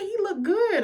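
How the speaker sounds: very high appreciative exclamation; impressed admiration. Retold or imitated. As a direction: gush it high and approving. Admiring the grown-up actor.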